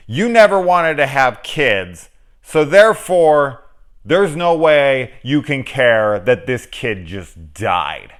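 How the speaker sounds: loud, high, slow outburst; acted accusation. Woman comes at him over not wanting kids, loud accusation. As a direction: hurl it as an accusation, drawn out.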